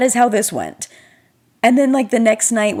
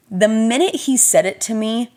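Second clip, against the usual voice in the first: normal talking voice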